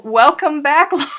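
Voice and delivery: loud, high, laughing welcome back to Lauren; excited welcome. Real, not acted. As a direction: cheer a friend's return with a laugh. Greeting returning friend.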